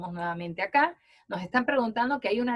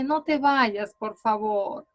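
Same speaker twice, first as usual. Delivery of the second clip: pleading; slower than usual. Shown as a demonstration. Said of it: "en sentido de una súplica".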